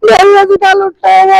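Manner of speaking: loud crying wail. Acted. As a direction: wail out that you were robbed. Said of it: "ਤੂੰ ਰੋਂਦੀ ਕਿਉਂ ਪਈ?".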